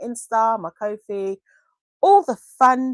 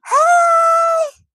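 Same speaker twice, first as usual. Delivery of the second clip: excited greeting; 8 dB louder than usual. Retold or imitated. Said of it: "And she's gone".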